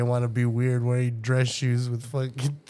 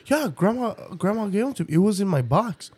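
high, childish mock kid voice; imitating her son; 9 semitones higher than usual. Mother imitating her son asking for his shoes, high mock kid voice. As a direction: play the clueless kid, pitched way up.

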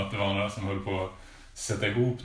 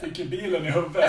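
higher-pitched Sundsvall dialect impression; Sundsvall accent; 8 semitones higher, 4 dB louder than usual. Co-host samples northern dialects, in a Sundsvall accent. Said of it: "eller snackar vi Sundsvall".

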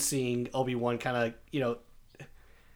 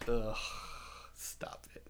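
annoyed ugh; 3 semitones lower, 11 dB quieter, slower than usual. Real, not acted. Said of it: "I like how you just echoed there".

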